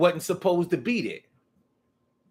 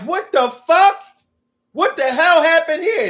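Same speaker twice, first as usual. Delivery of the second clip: much higher and louder, furious; angry owner shouting; 10 semitones higher, 8 dB louder than usual. Acted. Owner coming home to a mess, yelling at the dog. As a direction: yell at the wrecked room.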